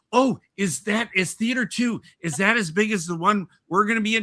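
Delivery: a bubbly theatergoer asking; lightly voiced excited woman; eager older lady. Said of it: "and then she goes".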